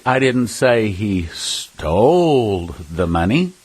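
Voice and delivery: STOLE drawn out long and slow; slow line hanging on a long "stole"; stress on STOLE. Shown as a demonstration. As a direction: stretch the word "stole" to cast doubt on it. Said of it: "with our voice inflection".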